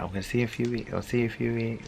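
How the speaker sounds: tender goodbye. Retold or imitated. Boyfriend kissing her goodbye at the car, soft tender promise. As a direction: lightly voice him promising to return, softly.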